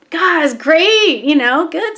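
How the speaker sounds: high, stretched-out delight; delighted praise. Retold or imitated. Mom praising son's first payment, high and delighted.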